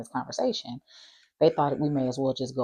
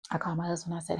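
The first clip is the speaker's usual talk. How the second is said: normal talking voice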